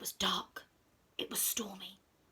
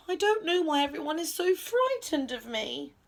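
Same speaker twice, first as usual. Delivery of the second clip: high, breath-filled lament; sighing; 7 semitones higher than usual. A narrator's reading. Monster confides in the friends, sighing. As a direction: sigh it out, puzzled. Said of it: "the monster sighed".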